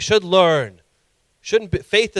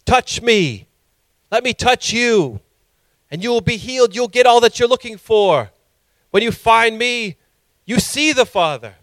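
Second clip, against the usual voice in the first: lightly voiced appeal, higher and drawn out; Yeshua; 7 semitones higher, 4 dB louder than usual. Preacher voicing the Savior inviting seekers in, earnest appeal. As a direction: plead slowly, one phrase at a time. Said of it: "What he's saying is".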